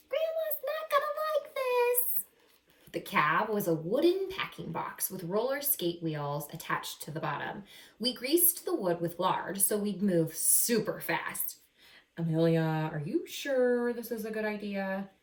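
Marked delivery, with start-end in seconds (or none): 0.1-2.3 put-on high, worried child voice; worried sister. Little sister warning Amelia, in a high worried voice. Sister warns about roller coaster. 12.2-15.1 a hint of a fretful little sister; doubtful sister. Sister questioning the plan, lightly voiced and doubtful.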